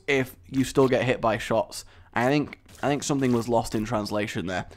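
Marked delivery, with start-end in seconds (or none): none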